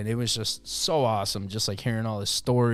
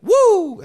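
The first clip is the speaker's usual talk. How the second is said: loud, high-pitched wrestler's woo; Ric Flair; 13 dB louder than usual. Retold or imitated. Wrestler wooing as he leaves the bar, high whoop. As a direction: let out a big showman's whoop. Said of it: "He fucking woos".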